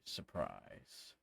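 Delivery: second surprise dropped low and slow; low drawn-out surprise. Acted. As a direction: drag "surprise." down deep and slow. Repeated taunt, dropped low.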